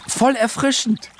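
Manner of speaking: put-on high, bright-pitched girl's shout; calling out. A narrator's reading. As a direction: call it out high, like a child. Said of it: "rief Lola". (hoher, kindlicher Ausruf)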